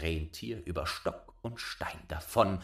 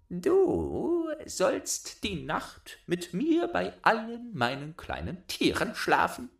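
put-on bossy little robber girl; 9 semitones higher than usual. A narrator's reading. Robber girl claiming Gerda, high bossy child voice. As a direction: demand it like a spoiled robber girl.

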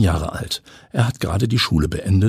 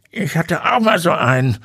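put-on old man voice; 10 semitones higher than usual. A narrator's reading. Old neighbour confides to Timo.

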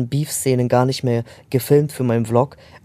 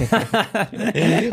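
high laugh; 8 semitones higher than usual. Real, not acted. Laughs while retelling the story.

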